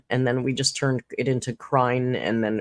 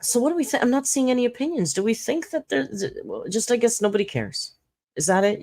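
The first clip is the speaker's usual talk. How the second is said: high teasing question; 8 semitones higher, faster than usual. Real, not acted. Probing the chat.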